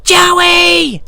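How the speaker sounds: high falsetto shriek; piercing drawn-out falsetto yell; imitating a woman calling. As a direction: call out in a loud mock-female screech.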